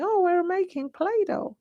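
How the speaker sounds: put-on high, singsong little-girl voice. Little girl on tape telling Oma what they're doing, high child voice. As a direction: pitch it way up like a small child. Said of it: "this little girl started saying".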